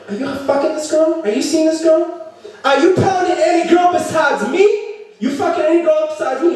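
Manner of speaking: high-pitched, hammed-up outraged girlfriend; jealous interrogation. Jealous girlfriend grilling her boyfriend about cheating, shrieky high outrage.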